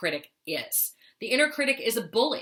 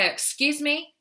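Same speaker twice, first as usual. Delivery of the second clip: higher-pitched, rising challenge; indignant comeback; 7 semitones higher, 3 dB louder, slower than usual. Acted. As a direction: act affronted, lift it into a question. Indignant comeback to inner critic.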